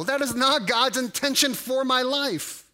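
normal talking voice

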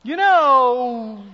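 high, loud, drawn-out you know; You know with a high, loud knowing fall. Retold or imitated. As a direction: scold with a pointed you know. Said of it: "Then we say another one".

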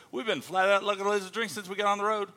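high-pitched, animated Aussie speak; Australian accent. Shown as a demonstration. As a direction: rattle off the slang like an Australian. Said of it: "I speak Australian".

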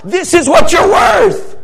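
high, loud and slow, each word hammered; preacher's shout. Acted. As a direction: shout the line to the back row. Climax of sermon point.

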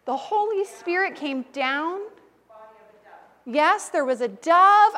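ordinary talk

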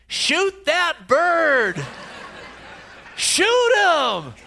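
high, loud, slow exasperated yell; woman yelling. Retold or imitated. Distant neighbor woman yelling at the pastor to shoot the owl, loud high slow holler. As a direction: holler across the neighborhood, fed up. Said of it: "I hear a woman's voice".

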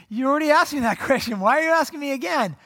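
high, loud, indignant questioning; exasperated protest. Acted. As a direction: protest being asked, pitch jumping up. Peter protests repeated question.

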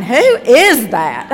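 loud, drawn-out mock bafflement; imitated baffled onlookers. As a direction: stretch it out like scandalised onlookers. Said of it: "They're going".

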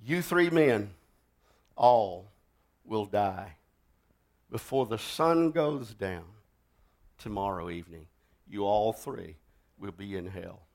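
preacher quietly foretelling the men's deaths; ominous prophecy. Acted. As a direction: pronounce their death sentence quietly. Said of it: "looked at him and said".

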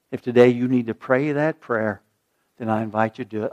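ordinary talk

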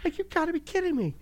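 retelling his reaction on the trampoline, mock exasperation; exasperated mock disbelief. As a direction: act out the disbelief comically. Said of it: "laughs Right? Like".

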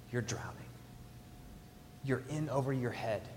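breathy whispered words. Real, not acted. Pastor whispering to his congregation. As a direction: drop to a whisper. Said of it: "pastor's hushed emphasis".

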